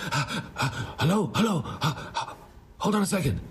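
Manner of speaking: imitated out-of-breath phone answer, high-pitched; Taras panting on the phone. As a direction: gasp between high, hurried hellos. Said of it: "this sort of running".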